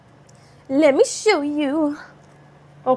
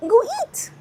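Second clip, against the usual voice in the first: squeaky go eat; 13 semitones higher than usual. Acted.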